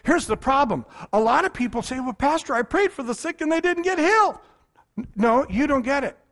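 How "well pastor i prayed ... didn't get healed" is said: higher, pleading complaint of a doubting believer; whiny doubter. Retold or imitated. A pastor voicing a doubter's high complaint. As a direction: play the disappointed churchgoer who prayed.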